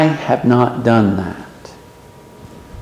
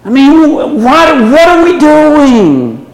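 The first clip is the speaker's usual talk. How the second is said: incredulous outburst; 11 semitones higher, slower than usual. Real, not acted. Preacher incredulous at idolizing addicts.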